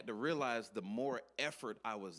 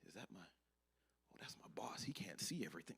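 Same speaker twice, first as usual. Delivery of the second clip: hushed, alarmed whisper, much softer; 2 semitones lower, 7 dB quieter than usual. Acted. Worker spotting his boss nearby, frightened whisper. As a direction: breathe it so the boss won't hear.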